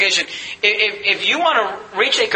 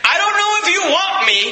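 crying out; 5 semitones higher than usual. Retold or imitated. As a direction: beg the Lord with all you have. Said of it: "you cried out to Jesus Christ".

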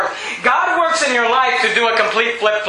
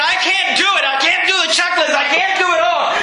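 anguished cry; 5 semitones higher than usual. Acted. Judah voiced crying out in despair.

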